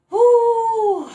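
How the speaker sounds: loud, high, long joyful whoop; excited "ooh". Real, not acted. Reader can't contain happiness at the cards, joyful whoop. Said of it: "really hard to contain myself and the happiness".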